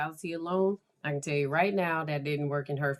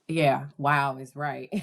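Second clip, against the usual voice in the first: usual speaking voice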